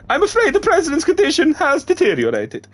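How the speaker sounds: very high, loud put-on character voice; Dead Man voice. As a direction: deliver the grim news in a high put-on voice. Dead Man reports president's condition.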